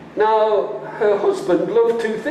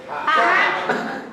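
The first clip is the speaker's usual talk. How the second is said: scornful ha; 9 semitones higher than usual. Shown as a demonstration. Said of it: "Can you do that with me".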